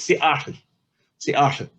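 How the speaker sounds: "Seattle" said with a glottal catch after the first syllable; native pronunciation of Seattle. Shown as a demonstration. Lecturer demonstrating the chief's real name to the audience, carefully pronounced. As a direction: Seattle the Lushootseed way, not the English way. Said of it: "you pronounce his names like this".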